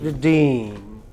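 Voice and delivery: "Redeem" with "Re" reduced and "deem" long; stress on second syllable. Shown as a demonstration. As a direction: barely say "Re", stretch out "deem".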